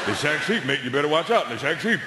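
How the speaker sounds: a touch of a sure English mate; authority and conviction. Retold or imitated. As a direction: warn him like it's settled fact. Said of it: "said it with so much authority and so much conviction".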